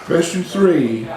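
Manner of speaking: slow, drawn-out question number; quiz question announcement. Acted. Quizmaster to the contestants, slow announcing.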